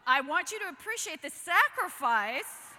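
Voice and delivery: loud, high, hammed-up indignation; mock dramatic. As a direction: playfully overplay the sacrifice. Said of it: "I wanted to say to Sandy Miller".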